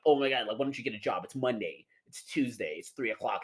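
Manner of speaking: low, soft sneering impression of critics; lightly voiced mocking of critics. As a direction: mimic the scolding conservatives. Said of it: "They're like".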